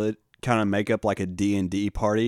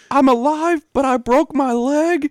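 much higher and louder, cheerful broken leg; goofy high-pitched fall victim; 7 dB louder than usual. Retold or imitated. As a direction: squeal it like a cartoon henchman. Will Ferrell character after falling.

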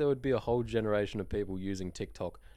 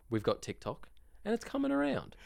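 ordinary talk

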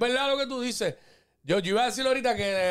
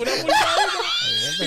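laughing repeat; 3 dB louder, slower than usual. Real, not acted. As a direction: burst out laughing, pitch jumping way up. Mocking brother's height claim. (repitiendo entre carcajadas)

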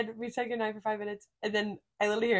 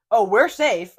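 sudden loud cheery reply; really loud; 12 dB louder, slower than usual. Retold or imitated. Roommate blurting loudly to passing guys. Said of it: "Like, really loud, just, like, in that same, like, voice".

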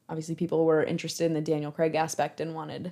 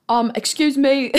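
attempted British accent, higher and louder; badly faked British accent, projected; Daniel Craig; 6 semitones higher, 6 dB louder than usual. Telling the story of the actor interrupting, botched British accent. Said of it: "should I do his accent?".

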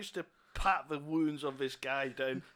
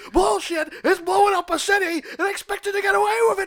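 loud, high disbelieving captain yelling; outraged captain; 14 dB louder than usual. Acted. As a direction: blow up at the reckless cop. Captain yells at cop.

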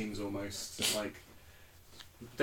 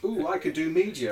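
mocking; 6 semitones higher, 7 dB louder than usual. Mocking friend.

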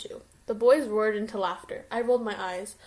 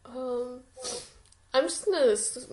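usual speaking voice

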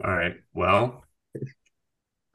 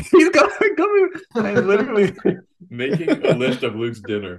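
laughing; 11 semitones higher, 5 dB louder than usual. Real, not acted. Teasing Luke for eating candy.

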